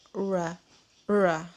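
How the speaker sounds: "ra" with an untrilled, English-style r; back-tongue R sound. Shown as a demonstration. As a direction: say "ra" with tongue drawn back on the palate. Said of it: "lidahnya ditaruh di langit-langit bagian belakang". (bunyi "ra", lidah di langit-langit bagian belakang)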